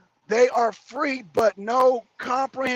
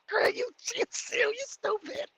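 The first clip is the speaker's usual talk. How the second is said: mocking squeak; 11 semitones higher, 8 dB quieter than usual. Insulting viewer in put-on voice.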